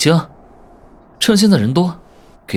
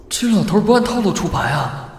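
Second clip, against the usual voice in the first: usual speaking voice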